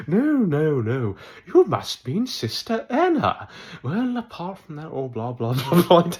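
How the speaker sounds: hammed-up high, swooping character voice; theatrical high voice sliding into blather; awful character impression. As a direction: badly impersonate a high-voiced game character. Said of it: "that was an awful impression".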